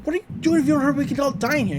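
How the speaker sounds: high-pitched imitated urgency; Dipper. Boy urging Zeus to flip the switch, high imitated urgency. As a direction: pitch it up, urging him to hurry. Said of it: "And Dipper's like".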